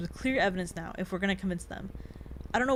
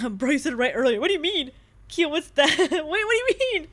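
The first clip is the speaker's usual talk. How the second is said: laughing; 6 semitones higher, 8 dB louder than usual. Real, not acted. Laughing at chat's joke.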